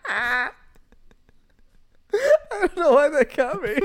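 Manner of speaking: high laughing, near tears; crying with laughter. Real, not acted. Laughing helplessly.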